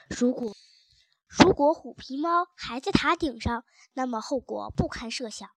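plain reading voice

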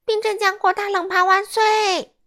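high, loud joyful cry; loud cheer. A narrator's reading. Qiuli cheering the frozen berry platter, loud and high. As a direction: cheer it out at full voice. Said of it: "秋丽大声说".

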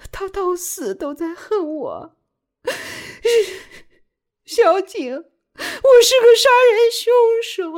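very high, anguished crying; wailing sobs. A narrator's reading. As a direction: bawl the confession out between sobs. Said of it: "放声嚎哭， 边哭边说".

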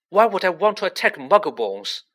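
high, loud indignant question; fierce. A narrator's reading. Harry defending himself to Ernie, fierce and indignant. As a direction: throw the question back fiercely. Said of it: "said Harry fiercely".

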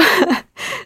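quick high giggle falling away; a sheepish little laugh at herself; short high laugh. Real, not acted. Laughing at herself about the eight flats, sheepish giggle.

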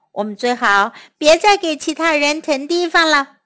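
ordinary delivery, nothing marked

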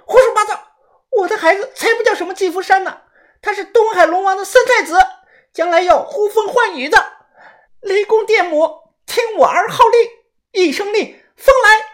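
high, loud manic proclamation; crazed shouting. Acted. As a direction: yell it like summoning a storm. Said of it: "她突然站在上铺大喊".

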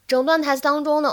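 ordinary talk

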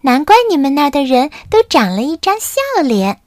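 gently amazed; marveling chick. Acted. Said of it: "卡门感叹道".

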